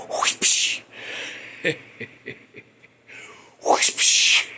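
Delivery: a soft, breathy swish of the paddle; whispered whoosh. Retold or imitated. Retelling the paddling, a whispered whoosh. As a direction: blow the sound of a paddle swinging.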